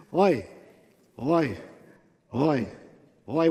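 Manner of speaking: evenly spaced oi of effort; groaning log carriers. Acted. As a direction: groan under the last load. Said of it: "they're carrying the last one".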